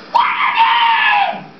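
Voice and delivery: very high, slow, crying shout; wailing plea. Acted. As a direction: howl it out in despair. Max's desperate plea to Bloom.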